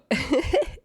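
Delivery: short high giggle; laugh. Real, not acted. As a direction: let out a quick high laugh. Joking at her own show's name.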